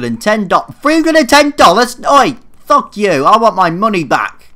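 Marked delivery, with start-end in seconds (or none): 0.8-2.0 much higher, louder shocked question; a shocked shout at the bill; outraged disbelief. Real, not acted. Outraged at the price. 2.0-4.5 loud, high outraged yell; angry shout. Real, not acted. Shouting at the seller.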